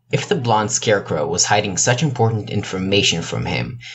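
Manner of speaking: ordinary delivery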